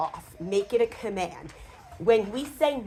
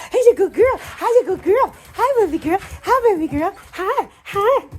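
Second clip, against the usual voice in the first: much higher, louder gushing puppy talk; excited baby talk; 13 semitones higher, 7 dB louder than usual. Retold or imitated. Owner greeting a jumping dog in high excited baby talk. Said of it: "So a lot of times when you have".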